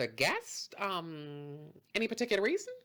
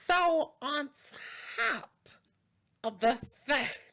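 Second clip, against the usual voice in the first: acted outrage; 8 semitones higher than usual. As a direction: make a hammed-up scene, word by word. Customer confronts host over reservation.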